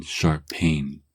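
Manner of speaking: usual speaking voice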